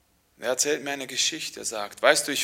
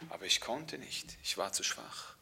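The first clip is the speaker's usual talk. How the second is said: weak and faint; 5 semitones lower, 12 dB quieter than usual. Acted. Weak woman tells her illness.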